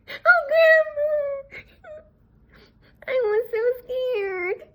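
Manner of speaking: put-on high, very slow, tearful child's voice; crying with relief; sobbing. A narrator's reading. The rescued girl sobs to her grandmother.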